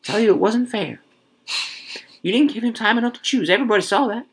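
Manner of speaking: high-pitched, insistent complaint; agitated protest. A narrator's reading. Wife appealing that he had no time, agitated protest. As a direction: plead the unfairness, pitched well up.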